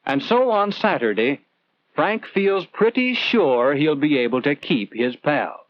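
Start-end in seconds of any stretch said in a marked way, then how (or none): none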